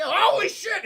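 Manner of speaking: high, loud startled yell; retold shout of sudden shock; mock shock. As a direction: blurt out an exaggerated cry of alarm. Retold shock at asteroid field.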